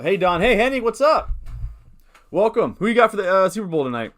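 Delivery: bright, loud hello to chat; excited greeting. Real, not acted. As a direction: welcome them with energy. Greeting new chatters.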